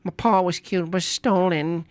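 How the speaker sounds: put-on jokey British accent line; lightly done British accent; mock British accent. Mock accent one-liner.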